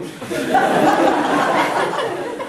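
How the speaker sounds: high, breathy burst of laughter. Real, not acted. The speaker laughing hard at the mother's reply. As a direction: laugh out at the joke.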